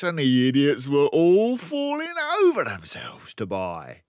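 high, loud, gloating salesman voice; boastful father. Acted. Father bragging to his son, loud and gloating. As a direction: brag about the tricks with relish.

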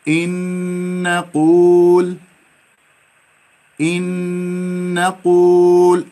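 إن نقول slow with long nasal nun; slow ghunna. Shown as a demonstration. Teacher reminds class of ghunna. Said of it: "والغنة لا تنسون".

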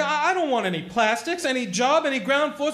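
angry outburst. Acted. George shouting at Mary he won't marry, angry. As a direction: lay down the law, rising fury.

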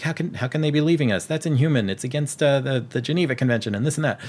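hammed-up outrage, pitched high; indignant outrage. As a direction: play up the shock of being left behind. Castaways outraged at being abandoned.

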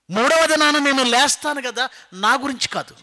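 high, loud insistence; emphatic proclamation. Acted. Jesus assuring the women he will rise, high loud insistence. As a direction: proclaim it as a certain promise.

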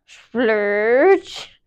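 breathy whispered splurge; whispered slurp sound. Acted. Reader making the moose kiss noise, whispered.

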